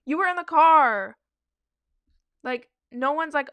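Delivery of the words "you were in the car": higher, louder indignant point; exasperated, she raises her voice; exasperated outburst. Real, not acted. Venting at the friend about the car, louder.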